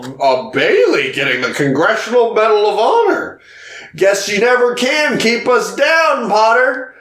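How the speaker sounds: crowing imitation of a proud uncle; mock gloating. Acts uncle rubbing it in.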